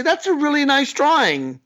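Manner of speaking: high, indulgent praise; humouring a child. Acted. Adult to five-year-old showing a drawing, high humouring praise. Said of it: "And what do you say?".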